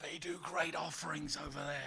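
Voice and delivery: low, hushed aside; acted scheming. Preacher as Paul's jealous rivals, quietly scheming. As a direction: drop the voice as if plotting.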